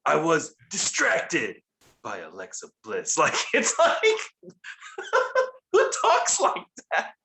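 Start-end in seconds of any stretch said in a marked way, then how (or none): 0.1-1.6 one word drawn out in mock anger; exaggerated slow, angry wrestling-promo delivery; Randy Orton angry. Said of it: "says one word really, like, angrily". 2.0-3.2 abruptly low, soft and calm; mock-calm end to a promo line; Randy Orton calm. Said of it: "says the rest of the sentence calmly".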